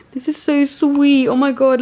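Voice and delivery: high, loud, drawn-out gush; overwhelmed delight. Real, not acted. Opening her prize from a favourite YouTuber, loud overwhelmed gush. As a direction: gush loudly over an unexpected gift.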